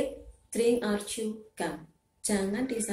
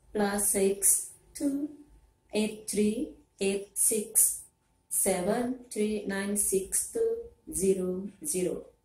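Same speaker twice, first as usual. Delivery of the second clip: slow dictation; much slower than usual. Acted. Caller dictates phone number.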